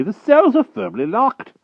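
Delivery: put-on high, loud, indignant old wizard; peevish wizard dismissing the giants' trouble; fussy old magician. A narrator's reading. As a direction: scold like a cross old sorcerer. Merlin protesting.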